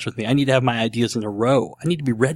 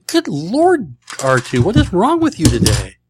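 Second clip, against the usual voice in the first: high, louder mock scolding; exasperated outburst; 6 semitones higher, 4 dB louder than usual. Real, not acted. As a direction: tell off the machine in disbelief. Scolding the die roller.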